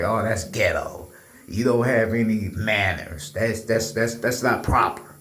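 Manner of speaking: lightly mocked proper talk, pitched low; a hint of prim, low-voiced disapproval. Imitating those who scold his way of talking, mock-proper voice.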